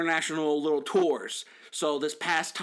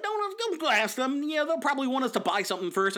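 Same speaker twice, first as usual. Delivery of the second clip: mock worried mom; 7 semitones higher than usual. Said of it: "she's like".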